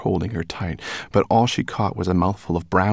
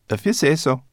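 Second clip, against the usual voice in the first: brownie voice; 8 semitones higher, 5 dB louder than usual. A narrator's reading. Brownie shrugs.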